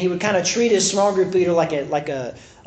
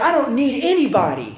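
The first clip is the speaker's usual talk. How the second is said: higher-pitched mock defiance; imitated mad defiance; 5 semitones higher than usual. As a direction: act out a defensive, proud retort. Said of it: "And he got mad".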